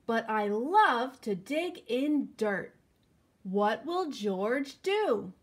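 put-on slow, lively storybook reading for kids; reading to children. A narrator's reading. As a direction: read it out like story time for little ones. Reading picture book to children.

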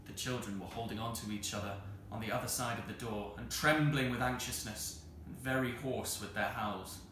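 ordinary delivery

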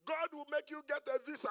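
loud, high proclamation; mock a rousing promise of blessing; prosperity preacher parody.

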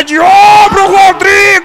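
high shouted name; excited shout. Real, not acted. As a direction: yell the keeper's name. Shouted save call.

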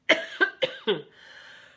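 harsh cough. Real, not acted. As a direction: cough hard into the mic. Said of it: "Who knows where this cough came from?".

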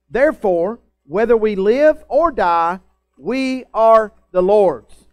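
slow, loud preaching, building to the end; preaching scripture. Acted. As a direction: proclaim it slowly over the music. Preacher reading Romans aloud.

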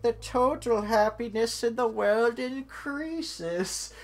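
high, loud, drawn-out meme voice; PewDiePie meme. Retold or imitated. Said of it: "reminds me of an old PewDiePie meme".

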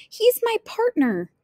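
very high, emphatic objection; insistent protest. Acted. Little Red defends the wolf to the woodcutter, insistent.

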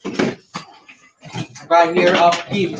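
slow, drawn-out threat with I stretched; slow warning. Acted. Teacher warning the class to stay silent, slow and drawn out. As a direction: stretch each word out like a warning.

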